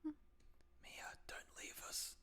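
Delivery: whisper, as if to someone slipping away; whispered mock plea. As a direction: plead in a hammed-up whisper. Mock plea to vanished friend.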